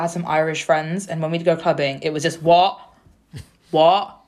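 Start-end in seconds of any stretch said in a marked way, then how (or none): 2.4-4.1 louder, baffled "what?"; mock not catching a word; retold loud "what?". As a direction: call it out as if over club noise. Retold club talk, not understanding.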